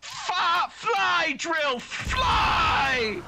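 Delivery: high, loud urging; cheering shout. Acted. Cheering on Drilf as he's fired from the cannon, loud and high. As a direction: cheer him on as he flies.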